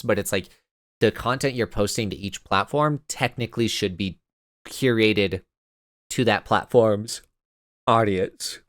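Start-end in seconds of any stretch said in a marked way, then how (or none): 4.7-8.6 yawning through the words; words stretched by a yawn. Real, not acted. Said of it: "I'm sorry I'm yawning again".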